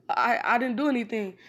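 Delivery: startled protest, hands up; defensive denial. Retold or imitated. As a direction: blurt it out like you've been caught. Kakashi hands up, accused.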